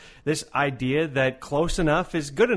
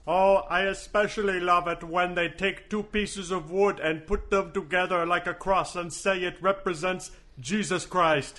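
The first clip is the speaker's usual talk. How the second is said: alien voice; 3 semitones higher than usual. Acted. Alien persona mocking.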